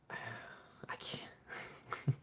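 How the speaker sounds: whispered, overwhelmed; whispering. Real, not acted. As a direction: breathe it out in a whisper.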